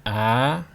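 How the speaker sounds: "Ah" rising from a neutral start. Shown as a demonstration. Teacher showing the starting point, "Ah" rising. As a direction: start "Ah" mid-range and slide up. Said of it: "Start at a neutral level".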